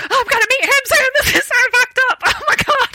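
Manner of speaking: high, fast, giddy squealing; giddy fangirl squeal; excited squeal. Retold or imitated. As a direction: gush it out, barely containing the thrill. Freaking out about meeting the star.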